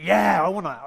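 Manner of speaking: loud, eager burst on "Yeah"; fired-up agreement. Acted. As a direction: burst out as if stirred by the poem. Acted stirred listener cheering along.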